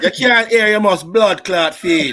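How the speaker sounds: loud, laughing Jamaican accent; slow laughing Jamaican patois delivery. Shown as a demonstration. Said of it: "do it in the Jamaican accent".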